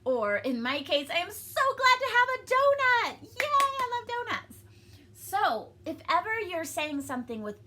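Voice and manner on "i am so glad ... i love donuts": very high, loud, thrilled squeal; gushing, excited cheer; great excitement. Shown as a demonstration. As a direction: exclaim it with over-the-top delight. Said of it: "like, an exciting thing".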